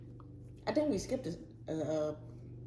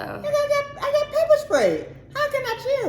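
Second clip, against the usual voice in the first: exasperated yell. Retold or imitated. To a friend telling her to chill, a high exasperated yell. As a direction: yell it, eyes burning and fed up. Friend maddened by pepper spray.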